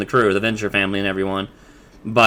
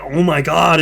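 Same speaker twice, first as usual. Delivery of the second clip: mock shock; 5 semitones higher, 3 dB louder than usual. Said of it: "their faces all show it".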